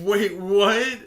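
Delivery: loud, high mock-shocked reaction; hammed-up double take; retold disbelief. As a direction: blurt it in amused disbelief. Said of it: "And I was like".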